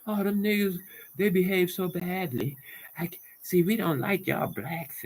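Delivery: high-pitched mimicry of disapproval; mocking the critics. Imitating those who talk down to us, high and mocking. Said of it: "And then talk about".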